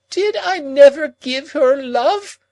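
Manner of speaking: put-on high, loud, slow wild old woman's cry; Miss Havisham crying out. A narrator's reading. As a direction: cry it out wildly as the jilted old lady. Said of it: "cried Miss Havisham, turning wildly".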